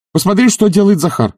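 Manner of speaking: high, aggrieved complaint; complaining. A narrator's reading. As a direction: complain to her about him. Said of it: "пожаловался он ей".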